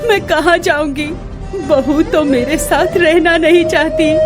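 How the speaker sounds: crying heartbroken to a late husband; weeping. Acted. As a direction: sob through the words in despair. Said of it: "फूट फूट कर रोने लगी".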